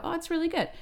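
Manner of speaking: high, falsely pleasant reply; high sugary customer voice; mock sweet customer. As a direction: play the fake-polite diner.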